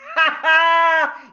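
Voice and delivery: high, loud whooping laugh; a man cackling at his neighbor's eviction; gleeful laugh. Acted. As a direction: burst out laughing with glee. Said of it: "can't hide his excitement".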